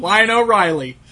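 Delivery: loud, high hype voice; mock toy announcer. Riffing on silly toy variants, mock announcer hype. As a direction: announce it as a big silly reveal.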